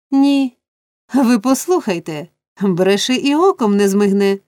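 ordinary delivery

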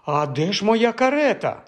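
high, alarmed question; fretful high-pitched demand; dismayed cry. A narrator's reading. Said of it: "зойкнула Фанні".

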